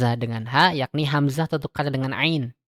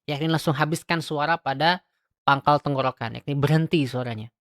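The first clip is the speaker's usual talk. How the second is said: ordinary talk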